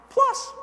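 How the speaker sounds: plus punched high and loud; stress on plus. Acted. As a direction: hit the word plus high. Reading the manual's altitude limit.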